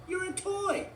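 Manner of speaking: very high contradiction; high, firm cartoon voice; insistent Forky. A narrator's reading. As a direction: squeak back in argument. Forky argues with shark.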